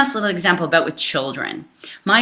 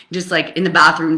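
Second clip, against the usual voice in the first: usual speaking voice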